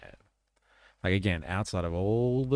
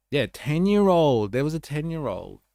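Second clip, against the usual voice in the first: usual speaking voice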